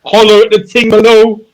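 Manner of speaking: very high, loud mock holler; hollered plug. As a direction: shout it out like a hype man. Hollers a mock social media plug.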